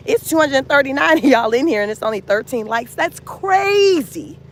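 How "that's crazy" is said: high, slow and stretched out; drawn-out disbelief. Real, not acted. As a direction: pull out crazy in mock outrage. Complaining about too few likes.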